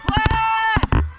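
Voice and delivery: high, loud drawn-out call; shouting. Real, not acted. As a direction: shout it to the whole field. Signalling out with flag.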